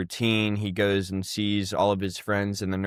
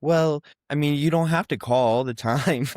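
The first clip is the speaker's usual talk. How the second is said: higher, louder hedging mom voice; imitated reluctant mom; 7 semitones higher, 4 dB louder than usual. Mom discouraging her daughter's frequent calls, imitated reluctance. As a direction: play mom letting her down.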